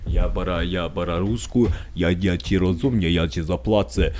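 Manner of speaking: low mock Russian accent, in Polish; shitty Russian accent. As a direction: put on a bad Russian voice for a side deal. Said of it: "That's my shitty fucking Russian accent talking".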